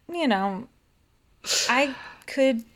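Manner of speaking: ordinary talk, nothing marked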